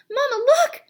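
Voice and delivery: high, loud squeak of joy; excited squeak. A narrator's reading. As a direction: cry out in delight. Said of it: "he squeaked".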